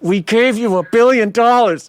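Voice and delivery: mock indignant outburst. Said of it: "I'm like".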